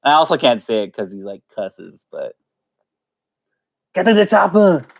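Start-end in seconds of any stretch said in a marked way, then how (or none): 3.9-4.9 high, urgent Austrian action-hero yell; Arnold Schwarzenegger. Acted. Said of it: "can't do his voice".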